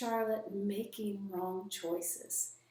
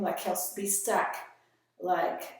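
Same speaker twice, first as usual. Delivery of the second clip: ordinary talk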